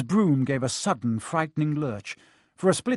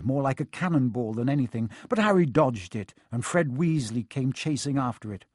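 ordinary delivery

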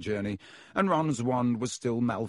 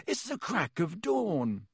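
slow, sleepy croak, a bit higher; 8 semitones higher, slower than usual. A narrator's reading. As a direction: croak it out slowly, half awake. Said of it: "Harry croaked".

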